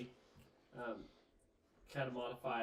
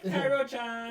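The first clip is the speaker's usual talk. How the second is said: imitated anime squeal; 10 semitones higher, 10 dB louder, slower than usual. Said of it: "we're over here like".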